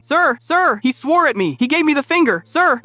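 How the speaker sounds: hammed-up tattling kid crying out to the teacher; whiny tattletale yell. Kid tattling to teacher, high mock yell. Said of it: "Ricky starts pointing at me and yelling".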